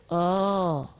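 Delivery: long, held "Oh"; O sound. Acted. Sock repeats the letter for puppeteer, long held "Oh". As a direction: puppet sounding out "Oh" as one drawn-out vowel. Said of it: "Say O".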